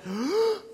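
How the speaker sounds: toddler's shriek, imitated; high, rising mock scream; high-pitched wail. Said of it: "high-pitched".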